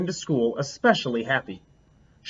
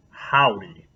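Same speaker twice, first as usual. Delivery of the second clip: mild teacher voice; 6 semitones lower than usual. Acted. Teacher greets class, winking.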